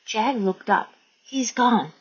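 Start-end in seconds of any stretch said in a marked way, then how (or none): none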